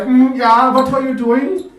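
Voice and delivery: high, loud, probing question; suspicious official. Retold or imitated. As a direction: challenge him like a nosy guard. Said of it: "he's like".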